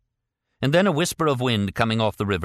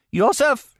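high shrieked name, much higher and louder; 6 dB louder than usual. A narrator's reading. Old woman shrieks the prisoner's name in despair. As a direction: shriek his name in anguish. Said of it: "she shrieked".

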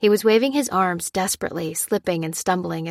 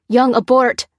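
higher, louder urgent cry; cried out; 5 semitones higher, 8 dB louder than usual. A narrator's reading. Lopez crying out for Young to abort, loud. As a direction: yell the order sharply. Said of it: "cried Lopez".

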